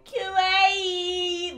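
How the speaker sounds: high cutesy squeal; anime girl voice. Pretend. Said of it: "only word ... as an anime girl".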